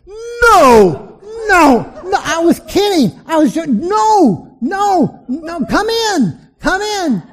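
high panicked backpedalling; panicked pleading. Retold or imitated. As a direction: beg him to stop, terrified. Host panics as pastor prays.

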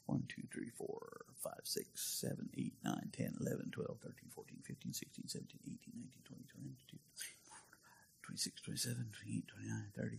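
counting heads under his breath; whispered counting. Real, not acted. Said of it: "so we got fifty-two today".

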